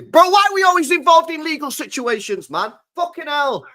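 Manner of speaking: venting real frustration, voice up high; exasperated rant. Real, not acted. Fed up with legal cases.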